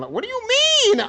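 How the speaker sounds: can't believe it; baffled. Real, not acted. Reacting to the twist, baffled. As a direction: jump way up, baffled. Confused by the story.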